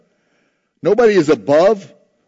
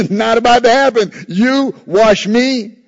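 high, loud, incredulous protest; emphatic refusal; 6 semitones higher, 6 dB louder than usual. Retold or imitated. Peter refusing to let Jesus wash his feet, loud emphatic refusal. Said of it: "is emphatic in the Greek".

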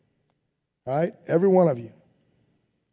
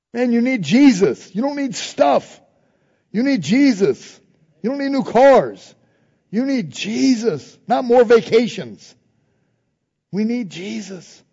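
passionate preaching; 4 semitones higher, slower than usual. Acted. Preacher urging congregation, passionate pulpit preaching. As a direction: hammer the point home, pulpit style. Driving home need for Jesus.

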